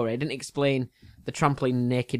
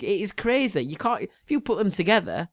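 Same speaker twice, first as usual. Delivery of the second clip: laughing; 7 semitones higher than usual. Real, not acted. Laughing at the lookalike.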